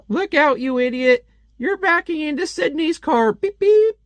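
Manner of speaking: high, loud hammed-up yell at a careless driver; mock angry driver. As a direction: squeal it out like a cartoon driver. Voicing an angry driver in comic.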